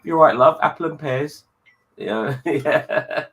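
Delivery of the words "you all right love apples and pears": high, loud Cockney market call; loud hammed-up Cockney accent; Cockney fruit seller. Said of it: "Cockney fruit seller".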